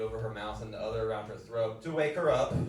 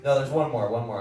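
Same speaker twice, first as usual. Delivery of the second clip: loud; 10 dB louder than usual. Real, not acted. Calling back the crowd.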